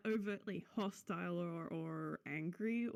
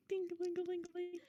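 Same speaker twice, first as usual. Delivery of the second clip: bell sound; 9 semitones higher than usual. Pretend. Player voices the bell sound.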